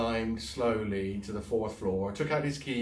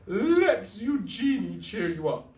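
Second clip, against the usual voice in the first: high, loud, slow; theatrical diva; 11 semitones higher, 7 dB louder, slower than usual. Acted. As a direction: announce yourself grandly. Eugenie before song.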